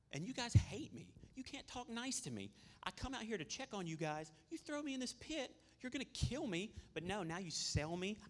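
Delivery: high-pitched, indignant complaint at betrayal; aggrieved Joseph. Acted. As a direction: protest in a hurt, rising, incredulous voice. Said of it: "had every right to be mad at his brothers".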